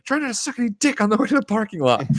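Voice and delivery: imitating Dante yelling after his girlfriend; mock taunting yell. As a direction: fling it after her out the door.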